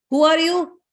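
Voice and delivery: high, demanding challenge; confronting Indra. Acted. Indra demanding the lady's identity, confronting.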